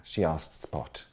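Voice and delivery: plain reading voice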